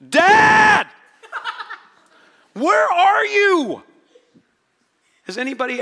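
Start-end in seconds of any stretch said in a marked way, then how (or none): 0.1-3.8 very high, full-volume cry; loud, long-drawn anguished shout; desperate yell. Acted. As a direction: scream out for a missing father. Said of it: "Sorry I yelled so loud".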